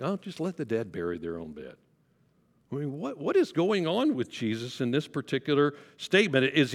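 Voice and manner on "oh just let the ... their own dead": low, soft and offhand, a shrugging Oh; sarcastic dismissive retort. Shown as a demonstration. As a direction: brush it off with dry sarcasm. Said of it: "Did he say it sarcastically?".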